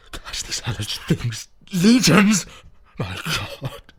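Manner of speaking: slow, breathy terror rising to a high cry; horrified cry. Acted. Warren seeing the hellish things below, horrified cry. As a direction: gasp it out in horror. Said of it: "swelled into a cry ... to a shriek".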